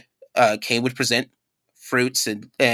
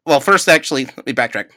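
ordinary talk, nothing marked

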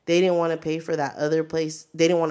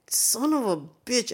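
higher-pitched exasperated curse; retold exasperation; 8 semitones higher than usual. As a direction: curse in mock outrage at bad news. Retold angry reaction to bad news.

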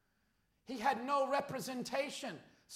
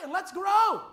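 shouted, higher rally to grow; loud call; 5 semitones higher, 9 dB louder than usual. Acted. Pastor urging the church forward, loud rallying call. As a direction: lift it up as a call. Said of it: "I said".

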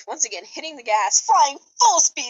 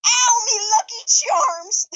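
leprechaun shriek; 8 semitones higher, 4 dB louder, slower than usual. Acted. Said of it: "The leprechaun shrieked".

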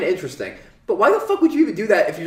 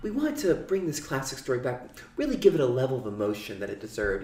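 much softer, earnest mock tone; mock pretentious director; 8 dB quieter than usual. Mocking the director in behind the scenes footage. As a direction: sound self-important and soft. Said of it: "the way they talk about".

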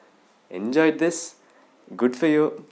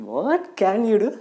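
higher, louder goading question; mock taunt; 7 semitones higher, 6 dB louder than usual. Owner daring his dog over the phone, taunting. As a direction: push the dare up in pitch and volume.

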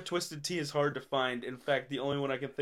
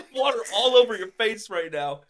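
laughing; 8 semitones higher, 8 dB louder than usual. Real, not acted. Friend sprayed water laughing.

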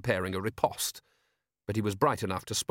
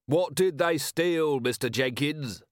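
slow, raised-pitch public question; inquiring crowd voice; 5 semitones higher, slower than usual. A narrator's reading. Onlooker questions the speaker, inquiring. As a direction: call the question out slowly from the crowd. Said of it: "said the inquiring voice".

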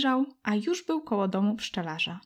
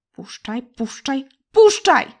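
put-on girl screaming; 4 semitones higher, 9 dB louder, slower than usual. Girl held up by the giant screams to be let go, building. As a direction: struggle and scream louder each time. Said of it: "krzyczała Poziomka".

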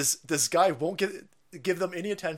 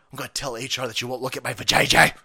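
usual speaking voice

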